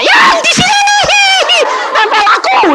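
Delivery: high, slow shrieking cry with a laugh; possessed wife screaming that it clings to her. Retold or imitated. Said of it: "istri saya teriak-teriak".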